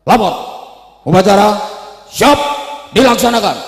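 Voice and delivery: high, very loud parade-ground shout; barked commander's report. Acted. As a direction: snap out each chunk at the inspector. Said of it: "Banser jadi komandan harus berani bentak camat".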